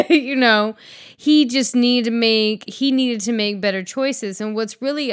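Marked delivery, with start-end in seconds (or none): 0.0-0.8 laughing. Real, not acted. Laughs at own joke.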